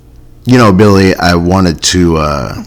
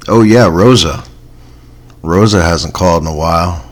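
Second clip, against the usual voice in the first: ordinary talk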